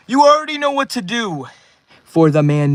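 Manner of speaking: high, loud hype call to chat; hype shout. Real, not acted. Hyping chat for a donor.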